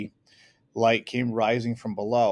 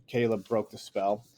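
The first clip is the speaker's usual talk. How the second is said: usual speaking voice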